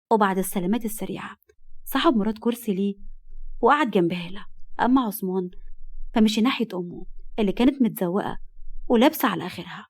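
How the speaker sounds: ordinary delivery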